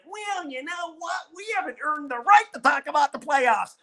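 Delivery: mock the GM's cautious excuse in a put-on pitch; sneering impression of a timid front office boss; mocking Scott Harris. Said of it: "a new guy in charge who's just like".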